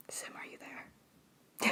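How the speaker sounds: soft unvoiced ASMR whisper. Real, not acted. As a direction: breathe the words, barely voiced. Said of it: "Don't do A S M R".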